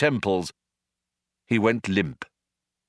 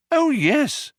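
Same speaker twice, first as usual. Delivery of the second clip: much higher, louder eager reply; eager Arthur; 4 dB louder than usual. Acted. Arthur eagerly agrees with the Vogon. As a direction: jump in eagerly. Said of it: "said Arthur".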